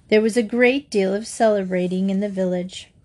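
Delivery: ordinary delivery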